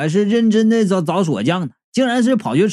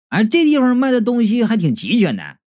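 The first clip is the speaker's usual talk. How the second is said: plain reading voice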